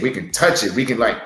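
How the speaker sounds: ordinary talk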